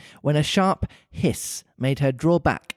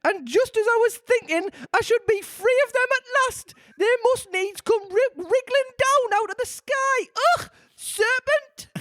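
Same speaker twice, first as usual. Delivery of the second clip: very high shrieking bird voice; pigeon shriek. A narrator's reading. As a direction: rise to a shriek. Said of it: "raising its voice to a shriek".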